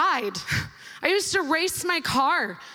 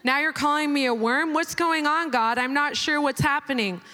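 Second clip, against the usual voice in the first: normal talking voice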